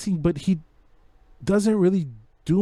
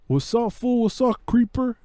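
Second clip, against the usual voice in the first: raised-pitch imitation of a weak tough-guy greeting; 6 semitones higher than usual. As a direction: ham up a bad gangster accent. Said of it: "Shia's accent is terrible".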